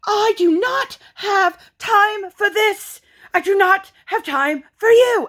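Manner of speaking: very high, slow, mimicked accented diva line; Inventing Anna. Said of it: "It's the trending audio from Inventing Anna".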